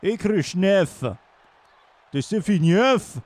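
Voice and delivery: slow, hammed-up Russian accent; Russian accent, played for laughs; mock Russian accent. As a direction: roll out the names with a joke Russian accent. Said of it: "L'accent russe".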